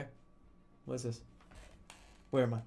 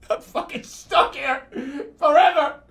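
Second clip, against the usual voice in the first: slow, high whine breaking into a laughing sob; hammed-up laughing despair, loud on forever; despairing wail; 9 semitones higher, 6 dB louder than usual. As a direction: wail it like you're trapped for good. Realizes he is stuck.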